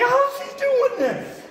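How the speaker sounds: high, drawn-out retold exasperation; mock-baffled cry, slow and high; exasperated disbelief. Storyteller reliving being outwitted by a bird, exasperated.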